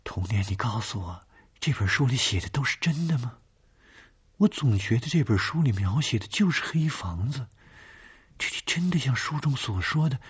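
high, uneasy questioning; anxious pleading. Acted. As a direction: ask anxiously, voice rising. Scared woman questioning husband.

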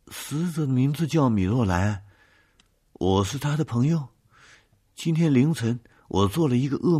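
high-pitched, loud, anxious character voice; frightened witness. Acted.

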